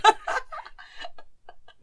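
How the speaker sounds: high airy burst of laughter; high breathy giggle. Real, not acted. Host laughing about Adam Driver voicing a Bob's Burgers character. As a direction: giggle with delight.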